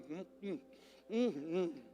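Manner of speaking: muffled mmm with water in mouth; closed-mouth humming. Shown as a demonstration. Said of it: "fica com a água na boca".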